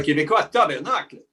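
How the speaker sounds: put-on Quebecois French pronunciation; Quebecois accent. Shown as a demonstration. British actor jokingly swearing for interviewer, Quebecois accent.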